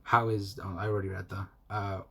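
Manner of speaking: ordinary talk, nothing marked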